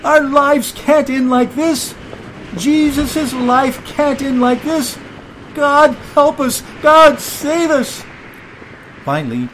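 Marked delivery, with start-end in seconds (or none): 0.0-8.0 high, loud panicked cries; desperate plea. A narrator's reading. Disciple begging God to save them from the storm, desperate shouting. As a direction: cry out to heaven for rescue.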